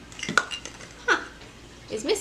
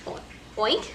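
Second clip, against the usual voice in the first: oink; 5 dB quieter than usual. Shown as a demonstration. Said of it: "What's a pig say?".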